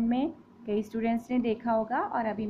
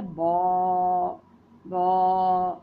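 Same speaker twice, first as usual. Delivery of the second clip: louder, lower mock bleat; sheep bleat imitation; 3 semitones lower, 6 dB louder, much slower than usual. Shown as a demonstration. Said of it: "sheep की sound होती है". (भेड़ की नकल, बॉ)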